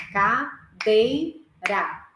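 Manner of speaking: very slow, split into three parts: ca-dei-ra; syllable by syllable. Shown as a demonstration. Teacher to class, splitting "Cadeira" into three slow syllables. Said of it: "eu vou falar em três partes".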